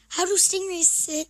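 normal talking voice

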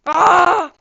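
sharp loud startled cry; startled yell. Real, not acted. Gamer startled by a hit, loud yelp. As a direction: yelp as something hits you.

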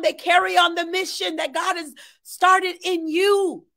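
ordinary talk

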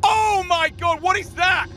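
high frightened exclaim; frightened cry. Real, not acted. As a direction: cry out scared. Frightened by something.

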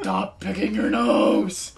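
slow, low rasping scold; Bat Dad. Acted. Dad scolds son about his nose, low Bat Dad voice. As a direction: scold slowly like a gruff superhero dad. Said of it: "I was doing Bat Dad".

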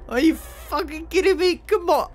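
high-pitched indignant protest; exasperated outburst. Real, not acted. Player whose pick lock broke, exasperated. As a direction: protest in disbelief, pitch shooting up. Lockpick breaks.

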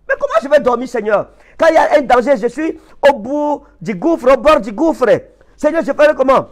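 high, urgent plea to the Lord; acted desperate protest. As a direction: plead with God from the edge of the abyss. Believer protesting to God's command.

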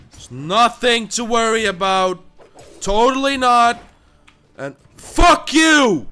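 forced reassurance rising into a loud shout; anger boiling over. Real, not acted. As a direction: climb from strained insistence to an explosive yell. False calm breaks into shout.

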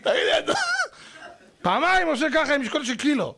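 high, squeezed through laughter; laughing disbelief. Retold or imitated. Lecturer mocking man lifting one kilo, laughing incredulously. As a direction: crack up while mocking the feeble effort. Laughs at feeble gym-goer.

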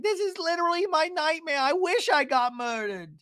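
high, whiny melodramatic lament; mock despairing wail. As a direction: imitate a reality star in hammed-up misery. Acting Gina's wailing talking head.

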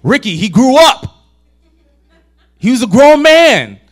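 shrill it out like a wife who has had enough; upset wife. Retold or imitated. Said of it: "his wife gets all upset".

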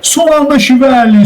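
high-pitched, stretched-out save call; excited interjection. Real, not acted. Commentator cutting in on co-commentator for a save, excited.

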